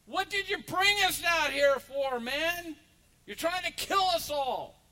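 loud, high, indignant shout at the leader; angry yell. Retold or imitated. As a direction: yell it as a furious grumbler in the desert. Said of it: "somebody yells out".

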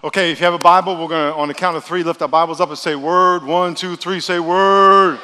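rally the crowd loudly; loud call-out. Real, not acted. Hyping congregation call-out.

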